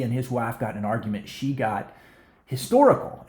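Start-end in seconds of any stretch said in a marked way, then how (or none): 2.5-3.3 "historical" jumps up high and loud, rising at the end; emphatic historical. Retold or imitated. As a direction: land hard on "historical", pitched well up. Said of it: "No, historical".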